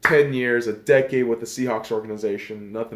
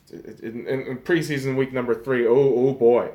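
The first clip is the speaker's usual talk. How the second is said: usual speaking voice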